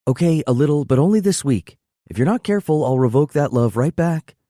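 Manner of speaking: playful mock threat; joking. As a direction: warn him jokingly. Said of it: "she jokes".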